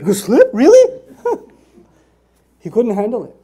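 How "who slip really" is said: laughing disbelief. Retold or imitated. Professor hears the translation.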